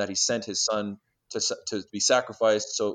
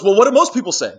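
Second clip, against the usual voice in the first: high and loud, mimicking a dodge; mocking the guy; 11 semitones higher, 13 dB louder than usual. Retelling the stranger's reply to the interviewer, high and loud mock voice. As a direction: throw the question back like the cornered stranger. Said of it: "he just goes".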